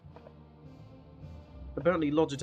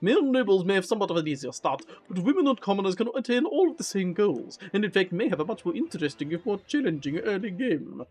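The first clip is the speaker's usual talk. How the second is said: louder, announcer-like game intro read; reading game text; 3 semitones higher, 3 dB louder than usual. Acted. Reads game intro text aloud.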